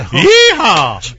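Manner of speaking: high, loud hillbilly whoop; fake cowboy accent. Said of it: "That was the Hick Lantern".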